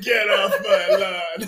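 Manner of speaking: slow, drawn-out loud yell; grumpy old man. Acted. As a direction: holler it slowly like a cranky old man. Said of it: "Half Sack as an old grumpy".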